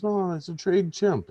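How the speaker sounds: drawn-out, lightly put-on owner voice; chimp owner. Owner explaining his chimp to the bartender, lightly voiced.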